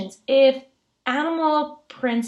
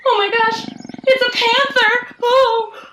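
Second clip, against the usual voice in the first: hammed-up high, loud shriek of alarm; mock-terrified high yelling; pretend panic; 12 semitones higher, 7 dB louder than usual. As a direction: cry out as if a wild animal jumped out, playing it up. Pretend fright at a panther.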